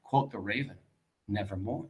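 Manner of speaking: soft, low, eerie Nevermore; hushed raven. Acted. Teacher voicing the raven to her class, hushed and low. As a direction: let the raven answer almost under the breath.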